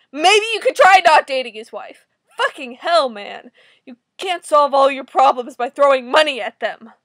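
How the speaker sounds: loud, exasperated telling-off; angry scolding. Real, not acted. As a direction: shout the obvious at the character. Scolding the comic character.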